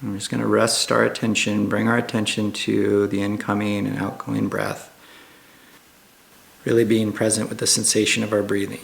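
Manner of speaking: calm, hushed meditation guide with long pauses; guided meditation. Acted. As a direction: ease listeners gently into stillness, unhurried and even.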